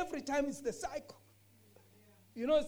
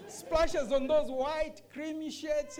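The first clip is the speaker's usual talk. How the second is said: slow words broken by real laughter; chuckling while picturing the coffee splash; laughing; 4 semitones higher, 6 dB louder, slower than usual. Real, not acted. Preacher laughing as he pictures coffee splashed on shirts, slow laughing voice.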